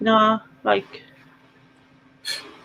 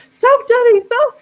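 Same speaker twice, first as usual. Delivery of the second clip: high, loud mock child's cry; little girl shouting; 5 dB louder, slower than usual. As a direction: imitate a panicking little girl calling to her dad. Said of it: "she's going".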